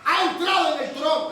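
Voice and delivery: the archangel shouts a warning through heaven; alarmed shout. Retold or imitated. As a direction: sound the alarm at the top of your voice. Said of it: "pegó un grito y dijo".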